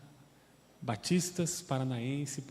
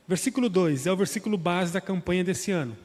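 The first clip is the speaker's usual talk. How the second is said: ordinary talk, nothing marked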